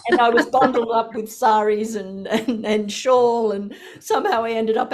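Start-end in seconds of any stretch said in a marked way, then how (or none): none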